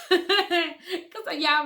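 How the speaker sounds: bursting out in a loud laugh. Real, not acted. Mother laughing at her own fear, loud laugh. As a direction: laugh through the word.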